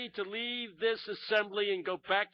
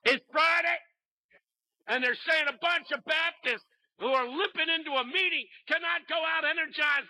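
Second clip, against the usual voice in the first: full-force preaching; 7 semitones higher than usual. Retold or imitated. Pastor rousing the Baptists with the refrain. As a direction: preach it at the top of your lungs. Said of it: "He went on like that".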